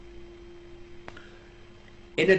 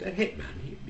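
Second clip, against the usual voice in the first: incredulous; 8 semitones higher, 7 dB quieter than usual. Acted. Two golfers react to hitman's claim.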